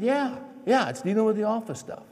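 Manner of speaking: high-pitched exasperated echo of the question; testy, mock-sweet retort. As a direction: snap the question back at your wife. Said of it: "I said it in a real sweet way".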